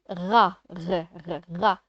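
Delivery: "Ra", then the bare R sound repeated; isolated first syllable. Shown as a demonstration. Teacher isolating the first syllable, "Ra, r, r, ra". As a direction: pick the R out of "Ra". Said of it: "La première syllabe".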